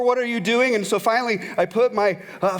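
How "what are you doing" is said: high loud frantic question; panicked yelling. Retold or imitated. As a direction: yell it again in panic.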